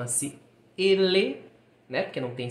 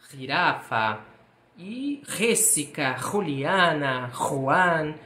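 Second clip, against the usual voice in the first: usual speaking voice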